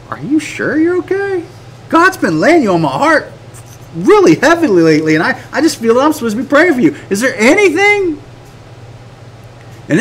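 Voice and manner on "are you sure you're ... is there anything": high, tentative, caring question; concerned check-in. Acted. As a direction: gently press a friend, voice lifting high. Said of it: "am I supposed to go".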